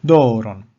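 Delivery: "Doron" with a loud, long DO, falling; stress on first syllable. Shown as a demonstration. Teacher showing perispomenon on penult, "Doron" stressed on DO. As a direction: stretch and punch the DO of "Doron". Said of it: "Here we have the accent upon the penult".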